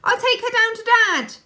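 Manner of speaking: high loud call. A narrator's reading. Laura leaving with the baby, high loud call.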